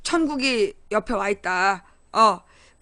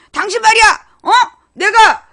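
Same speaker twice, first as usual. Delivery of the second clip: higher, louder mock confrontation with the husband; mock quarrel; 6 semitones higher, 7 dB louder than usual. Wife confronting husband over the freezer, loud and higher. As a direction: pick a fight loudly, pointing a finger. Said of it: "뭐 이렇게 따지면 안 돼".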